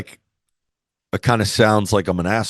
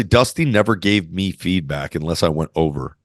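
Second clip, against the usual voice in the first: usual speaking voice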